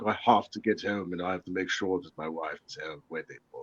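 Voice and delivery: very deep, hushed mock-Australian accent; Chris Hemsworth accent. Showing friends his accent, hurrying home to wife, Chris Hemsworth style. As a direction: sink far down into a soft, heroic Australian accent. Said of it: "like, the Chris Hemsworth thing".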